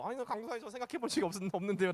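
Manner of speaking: quick, soft imitation of a child's answer; imagined child's reply. Preacher voices the answer feared from the children, light quick mimicry. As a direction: lightly mimic a kid saying no, quietly. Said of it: "강도사 살짝 걱정했어".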